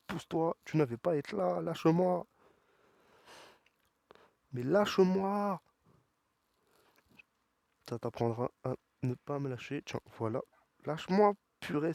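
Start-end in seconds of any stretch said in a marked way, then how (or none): 0.1-5.6 higher, pleading yell of frustration; exasperated yelling. Real, not acted. Gamer yelling at the wrestler grabbing him, exasperated higher shout. 10.8-11.9 exasperated yell. Real, not acted. Gamer yells at grabbing wrestler.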